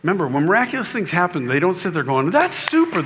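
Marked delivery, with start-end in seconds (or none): 2.3-3.0 high-pitched, sing-out fake approval; mock delight. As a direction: chirp it high in mock wonder. Mock calm reaction to miracle.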